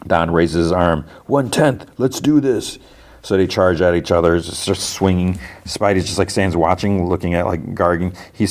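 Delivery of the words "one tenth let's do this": higher-pitched mock rallying call; rally the gang in a light, mock battle cry; retold battle cry. Gang leader raising his arm to charge, retold battle cry. Don rallies gang to fight.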